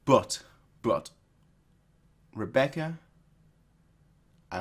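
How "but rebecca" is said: usual speaking voice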